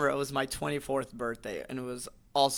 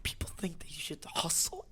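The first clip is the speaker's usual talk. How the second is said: whispery, high raspy motivational voice; raspy hustle-guru voice; Gary Vee impression; 5 semitones higher, 7 dB quieter than usual. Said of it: "I did an impression of him".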